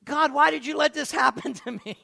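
loud, high-pitched imitation of wailing at God; mock anguished complaint. Preacher mimics believer blaming God, loud mock anguished complaint. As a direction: protest to heaven, hammed up.

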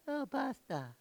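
mock half-hearted "Oh, basta", high and soft; imitating feeble response. Preacher mimicking how the congregation said it, mock-feeble and soft. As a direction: mimic a timid, weak "Oh, basta". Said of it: "Yeah, y'all like".

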